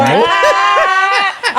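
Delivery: sudden real laugh, pitched high; burst of laughter. Real, not acted. Cohost teasing the guest, bursting into real laughter. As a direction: laugh out at your own teasing.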